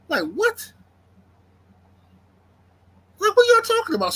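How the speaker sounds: high-pitched, baffled disbelief; incredulous reaction. Retold or imitated. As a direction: act out being totally thrown, voice shooting up. Retelling his baffled reaction to the uproar.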